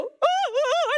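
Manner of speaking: high-pitched mock wailing "ooh"; hammed-up sobbing hoots; imitated crying. Mother hearing of daughter's baptism while away, imitated crying. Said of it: "and I start crying".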